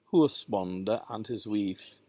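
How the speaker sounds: "husbonde" with oo, "wife" as "weef"; Middle English OU. Shown as a demonstration. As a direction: say "husbonde" as "hoos-bon-duh". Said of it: "the oo".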